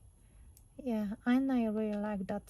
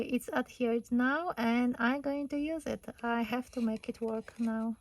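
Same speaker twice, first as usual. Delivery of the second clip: ordinary delivery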